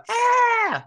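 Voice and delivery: sustained high, loud "aah"; high-pitched mock shriek; mock scream. Mimics a scream at the film.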